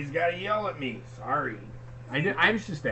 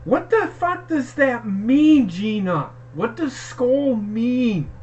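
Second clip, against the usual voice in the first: slow, higher, incredulous demand; exasperated; 8 semitones higher, 7 dB louder, much slower than usual. Real, not acted. Husband demanding Gina explain skull, exasperated and slow. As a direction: drag out the outrage. Confused angry outburst at Gina.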